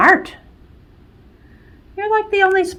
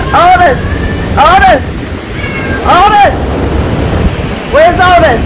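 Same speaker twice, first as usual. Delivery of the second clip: calling out; 5 semitones lower, 5 dB louder than usual. Real, not acted. Calling for missing dog.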